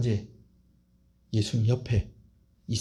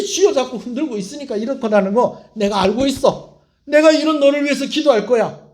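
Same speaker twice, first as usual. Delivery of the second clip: impassioned Jesus; 4 semitones higher, 4 dB louder than usual. Acted. Jesus crying out to Peter not to give up, impassioned. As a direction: preach it, plead him back at full force. Jesus pleading, rising to climax.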